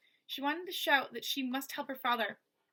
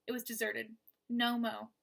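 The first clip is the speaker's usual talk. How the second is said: ordinary delivery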